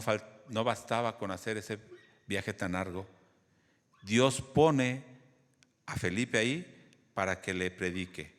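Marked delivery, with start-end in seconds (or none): none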